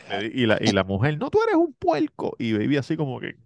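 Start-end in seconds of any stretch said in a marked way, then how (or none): 1.2-2.4 much higher mock shriek back; hammed-up squabbling wife; shrill mock wife. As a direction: fire it back like a bickering wife.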